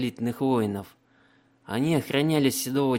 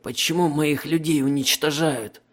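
wounded prisoner voice; 4 semitones higher, 3 dB louder than usual. Acted.

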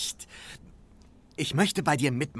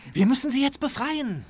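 urgent eagerness; 12 semitones higher, 4 dB louder than usual. Acted. Urgent push to rescue.

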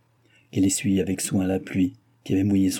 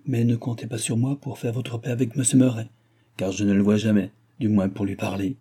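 ordinary delivery, nothing marked

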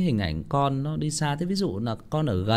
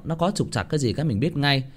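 usual speaking voice

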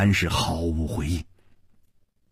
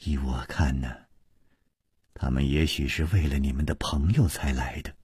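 softer and lower; quiet; 6 semitones lower, 6 dB quieter than usual. A narrator's reading. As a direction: suggest it gently under her breath. 南平 speaks softly. (轻声低语)

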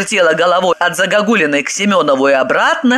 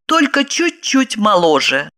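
usual speaking voice

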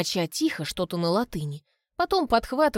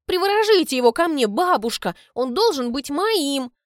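pleading demand; 6 semitones higher, 4 dB louder than usual. Acted. Albina demands love spell.

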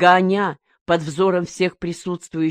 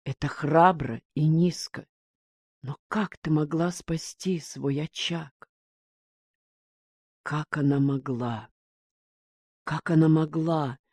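soft, subdued wondering; shaken inner thought; 7 dB quieter than usual. A narrator's reading. Son shaken by his mother's confession, quiet disbelief. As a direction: think it aloud quietly, mildly stunned. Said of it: "потрясённый этим признанием".

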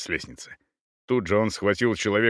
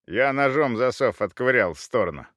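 usual speaking voice

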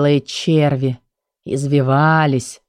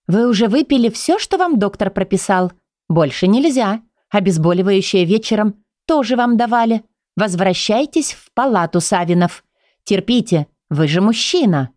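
higher, stern nurse voice; 4 semitones higher than usual. Acted. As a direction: firmly send him back to bed. Nurse scolds patient.

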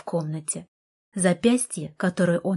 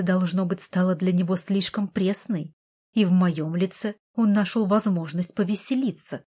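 usual speaking voice

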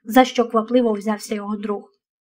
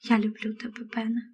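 hushed, much softer confession; whispering; 10 dB quieter than usual. A narrator's reading. Шері confessing love to Бен at parting, whispering. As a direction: whisper it tenderly, barely voiced. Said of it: "прошепотіла Шері".

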